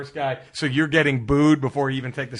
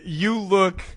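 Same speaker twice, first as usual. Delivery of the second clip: usual speaking voice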